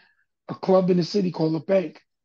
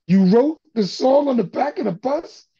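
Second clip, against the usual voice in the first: loud, higher echo of disbelief; incredulous; 5 semitones higher, 6 dB louder than usual. Retold or imitated. Crowd learning where he wrote the song, incredulous. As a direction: imitate someone who can't believe the answer. Retold listeners' disbelief at answer.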